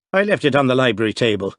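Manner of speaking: fast, flustered Irish brogue. Acted. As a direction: rush it in a thick Irish brogue. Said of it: "his brogue deepening in his disturbed mood".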